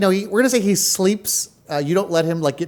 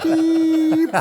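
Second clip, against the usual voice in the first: buzzer beep; 11 semitones higher than usual. Acted. Imitating door buzzer.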